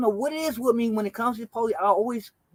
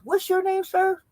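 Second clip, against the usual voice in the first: lightly voiced cop, pitched high; mock cop voice; 11 semitones higher than usual. Officer asking the cousin his name, mock polite. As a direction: ask it in a put-on polite officer tone. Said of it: "He says".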